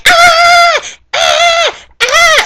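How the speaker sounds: very high, loud excited squeals; mock girly screaming. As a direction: imitate the girls' shrill shrieks. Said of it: "they're like".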